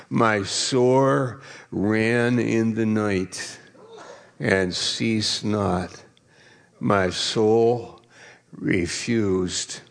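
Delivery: slow, fading solemn psalm reading; scripture reading. Acted. Reads the psalm aloud.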